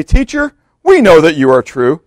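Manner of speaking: butter him up with exaggerated false respect; sickly flattery. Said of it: "Doesn't that make you barf?".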